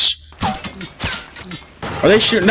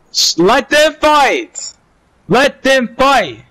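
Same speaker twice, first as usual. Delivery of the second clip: slow, higher, drawn-out urging; urgent, drawn-out; 5 semitones higher, slower than usual. Real, not acted. Gamer urging his squad to let the enemies fight, drawn-out urgent call. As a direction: stretch it out, begging them to hold back.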